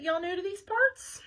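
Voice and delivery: lightly voiced Southern diner waitress; deep Southern accent. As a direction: ask it with a Southern twang, rising at the end. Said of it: "she said in a deep Southern accent".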